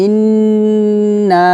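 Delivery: "ইন্না" chanted with a long nasal hum; long ghunnah. Shown as a demonstration. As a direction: hold the n of "ইন্না" very long, then stretch the a. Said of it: "গুণনা করব". ("ইন্না"-তে লম্বা গুন্নাহ)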